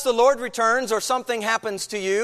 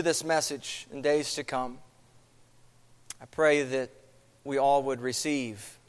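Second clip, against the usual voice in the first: opening prayer; 4 semitones lower than usual. Acted. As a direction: pray humbly before the congregation. Pastor leads an opening prayer.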